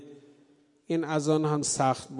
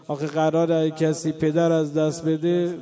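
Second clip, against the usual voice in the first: usual speaking voice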